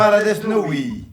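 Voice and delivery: loud, high insistence; heated banter. Real, not acted. Fan saying Chiefs cannot win, loud and insistent.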